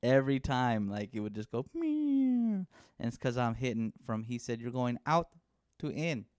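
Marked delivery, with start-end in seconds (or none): 1.7-2.6 high, squeaky ball whistle; squeaky meow sound. Retold or imitated. Imitating golf ball slicing sound. 4.6-6.2 put-on lightly done Mexican accent, a bit higher; a hint of a Mexican dad's accent. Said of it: "Is that supposed to be your Mexican accent?".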